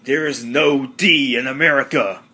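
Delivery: insist: there is no D in America, America said with a clear R; correcting a mispronunciation. Retold or imitated. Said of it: "you can't say America".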